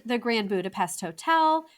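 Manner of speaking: ordinary delivery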